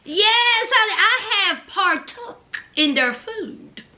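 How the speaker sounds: usual speaking voice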